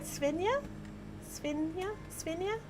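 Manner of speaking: tentative, rising on each "Svenja"; unsure pronunciation attempts. Real, not acted. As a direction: try out "Svenja" softly with a high questioning lift. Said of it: "did I say it right".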